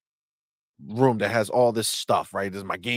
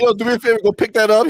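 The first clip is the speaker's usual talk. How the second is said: much higher, louder, giggling through it; laughing request; 11 semitones higher, 8 dB louder, much faster than usual. Retold or imitated. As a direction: ask while cracking up. Dad asks kid, laughing.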